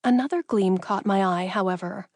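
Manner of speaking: usual speaking voice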